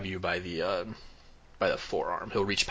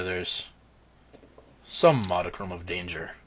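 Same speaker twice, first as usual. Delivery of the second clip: lightly voiced dwarf smith, openings punched louder; dwarf forge master; 4 dB louder than usual. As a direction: play a good-humoured old smith, measured, with long pauses.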